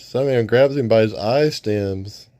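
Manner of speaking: ordinary delivery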